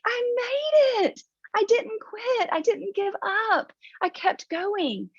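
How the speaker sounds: high, slow and overwhelmed; emotional triumph. Retold or imitated. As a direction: tell him you made it, choked with relief. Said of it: "And I was like".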